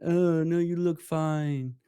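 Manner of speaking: mock sugarcoating. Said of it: "I don't want you sugarcoating shit".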